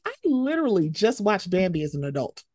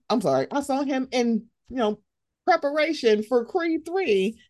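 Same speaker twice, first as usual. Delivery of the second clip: usual speaking voice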